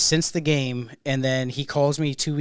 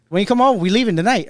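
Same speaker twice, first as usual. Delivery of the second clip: put-on Memphis accent; 7 semitones higher, 9 dB louder than usual. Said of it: "He got a fucking accent".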